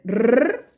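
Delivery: a loud rolled "Rrr"; teacher models a loud trilled "Rrr". Shown as a demonstration. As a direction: roll the R firmly. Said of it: "sonido de la doble R".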